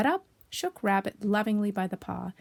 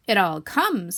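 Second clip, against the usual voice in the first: loud, firm scolding; emphatic, reproving retort; stern; 7 dB louder, much slower than usual. Acted. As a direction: lay down the blame sternly. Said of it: "said Rabbit sternly".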